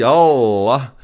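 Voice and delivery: Allah drawn out, the double L held long and slow; Allah bem lento, L prolongado. Shown as a demonstration. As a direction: linger on the L of Allah. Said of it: "esse dois L fica mais tempo".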